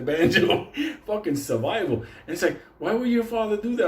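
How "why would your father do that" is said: high, appalled mock voice; shocked question. Camper asking about the father, high shocked voice. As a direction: ask it high, in disbelief.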